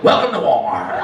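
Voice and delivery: low mock greeter's welcome; store greeter. Greeter at the store hospital, low mock welcome.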